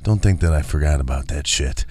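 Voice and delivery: a menacing mock wife, low and hushed; low menacing threat. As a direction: growl it under your breath as a warning.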